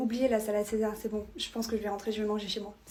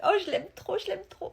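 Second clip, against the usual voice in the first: lovestruck gushing; 7 semitones higher than usual. Acted. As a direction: gush high, then let it fade. Gushing about a new crush. (amoureuse transie, voix aiguë)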